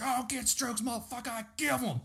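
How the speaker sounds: high-pitched mock scream, held back in volume; strained high imitation of a shouted comeback; imitating drunk friend's catchphrase. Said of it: "John used to say that shit".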